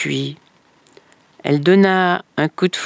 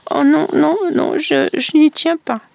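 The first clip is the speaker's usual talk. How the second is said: a lightly voiced girl, higher, hesitant refusal; frightened girl refusing; 10 semitones higher than usual. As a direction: stammer a refusal like a scared child, higher.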